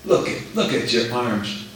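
whispering tempter. Acted.

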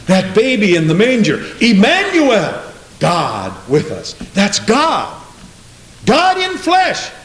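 emphatic preaching, slow on the key words; ringing pulpit proclamation. Real, not acted. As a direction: proclaim it, climbing to a high cry. Preaches at rising force.

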